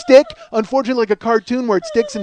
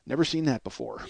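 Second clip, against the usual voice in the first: low, quiet deadpan; Paul Lane; 9 semitones lower, 12 dB quieter than usual. Retold or imitated. Club man walking into office after the chaos, quiet deadpan. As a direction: drop it low and soft, utterly unbothered.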